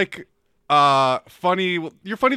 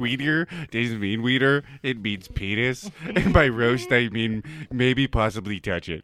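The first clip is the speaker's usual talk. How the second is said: high school Chad; 7 semitones lower than usual. Pretend. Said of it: "This is high school Chad here".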